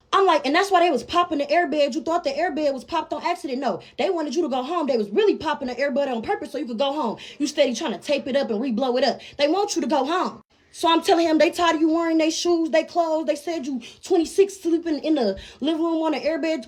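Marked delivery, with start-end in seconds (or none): 0.5-10.4 high-pitched, relentless retold tirade; going off on him. Girl to uncle downstairs, going off on him. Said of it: "I'm just going off on him". 11.4-16.6 high-pitched retold dressing-down; going off on him. Girl to uncle, going off on him. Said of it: "I'm just going off on him".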